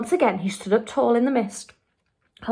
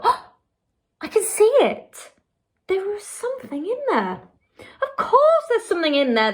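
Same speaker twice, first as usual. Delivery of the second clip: excited cry; 11 semitones higher than usual. A narrator's reading. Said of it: "cried out".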